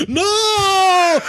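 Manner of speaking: long, high-pitched melodramatic cry; hammed-up drawn-out howl; mock yell. Co-host imitating Imhotep sliding in, hammed-up anguished yell. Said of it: "just yells".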